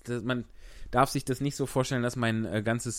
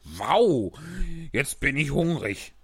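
king voice; 3 semitones higher, slower than usual. Acted. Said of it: "sagte der König".

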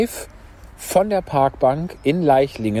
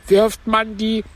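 high, plaintive turtle voice; Mock Turtle; 11 semitones higher, 3 dB louder, slower than usual. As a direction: pitch it up for the tearful turtle. (hohe Stimme der falschen Schildkröte)

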